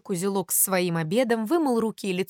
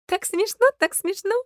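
very high, tiny titmouse; high-pitched tickled little bird; thin little giggly voice; 9 semitones higher than usual. Acted. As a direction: twitter with amusement in a thin high voice. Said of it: "засмеялась тоненьким-тоненьким голоском".

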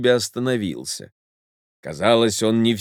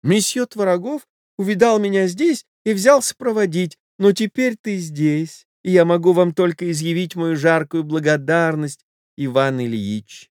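high, breathless society lady voice; a frightened wife begging him off; flustered lady; 8 semitones higher than usual. Acted. Wife pleading with her lover to leave, high flustered voice. Lady's voice.

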